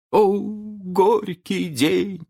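very high, long-drawn theatrical moan; moaning lament. A narrator's reading. Starkey laments the bitter day to his captain, moaning. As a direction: wail it slowly as a woeful pirate. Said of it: "простонал старкий джентльмен".

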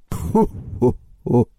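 slow, low villain's "ho-ho-ho"; ominous laugh. A narrator's reading. Leader bird gloating over its captive, ominous laugh. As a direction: laugh slowly and menacingly, down low. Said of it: "громко и зловеще".

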